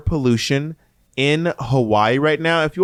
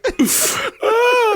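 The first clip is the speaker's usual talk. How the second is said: a high, crying squeal of laughter. Real, not acted. Laughing hard at his own mock correction, high squeal. Cracking up.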